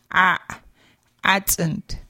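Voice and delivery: "accent" with a throaty a stopped short; cut-off pharyngeal. Shown as a demonstration. Teacher modelling glottal pharyngeal for learners, cut-off a. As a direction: stop the throaty "ah" abruptly. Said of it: "an abruptly ended pharyngeal".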